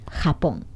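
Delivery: ハポン with a soft, breathy h; ハポン con jota aspirada suave; breathy Spanish j. Shown as a demonstration. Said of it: "はーって抜けるんですよ".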